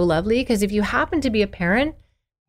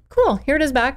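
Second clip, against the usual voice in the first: breezy parent; 6 semitones higher than usual. Retold or imitated. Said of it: "It's like".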